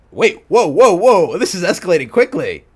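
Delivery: loud, high string of whoas, then rushed; startled outburst. Real, not acted. Reader halting the fanfic at a sudden twist, startled. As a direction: blurt it out as the story runs away.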